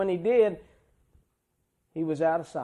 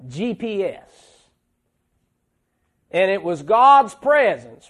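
louder, fervent pulpit preaching; preaching at full force; 5 semitones higher, 8 dB louder, slower than usual. Acted. Preacher thrilled about God's closeness, preaching hard. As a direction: drive the words home from the pulpit.

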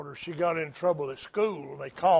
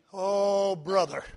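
normal talking voice

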